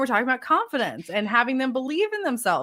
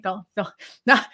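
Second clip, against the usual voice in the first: ordinary talk, nothing marked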